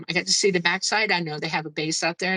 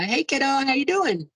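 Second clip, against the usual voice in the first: friendly voice in head; 7 semitones higher than usual. Retold or imitated. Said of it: "I hear this voice in my head".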